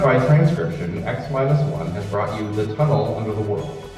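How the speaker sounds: usual speaking voice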